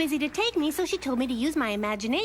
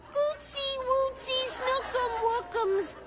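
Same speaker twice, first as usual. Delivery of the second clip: put-on high, slow sing-song coo to a baby; cooing baby talk; 9 semitones higher, slower than usual. Kid imitating a mother cooing at her baby, slow and high. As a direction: mimic a mother cooing into a pram. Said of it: "lean down into a baby carriage and say".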